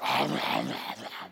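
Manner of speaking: low, soft caveman munching; noisy wordless wolfing-down of food; mock gobbling noise. Esau gobbling instead of answering.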